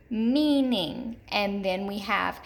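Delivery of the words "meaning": "meaning" with a drawn-out long E first; stressed first syllable. Shown as a demonstration. Teacher repeating an example, "meaning" stressed on the long first syllable. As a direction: stretch the "mean" in "meaning". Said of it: "hear that long E in that first syllable".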